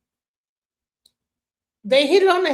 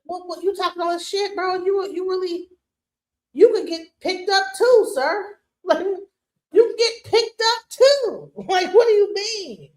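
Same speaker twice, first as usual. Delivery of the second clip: higher, laughing mock disbelief; mock incredulous; 7 semitones higher, 3 dB louder than usual. Teasing the accented caller with higher, laughing disbelief. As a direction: tease him, half laughing. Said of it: "I was like".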